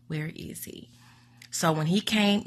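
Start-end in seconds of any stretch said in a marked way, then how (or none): 0.1-0.9 breathless whispered plea; whispered, exhausted repeat; desperate whisper. Retold or imitated. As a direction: whisper it as if all strength is gone.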